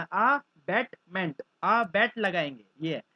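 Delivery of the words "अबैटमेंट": अबैटमेंट drawn out slowly, split as अ बैटमेंट; slow split word. Shown as a demonstration. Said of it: "अबैटमेंट याद करना". (अबैटमेंट को धीरे धीरे तोड़कर बोलो)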